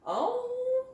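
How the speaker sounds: high rising oh of surprise; sudden high oh; surprised oh. Real, not acted. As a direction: let the surprise lift. Surprise as stick sinks.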